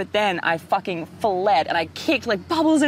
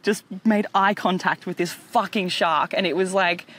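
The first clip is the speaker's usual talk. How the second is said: usual speaking voice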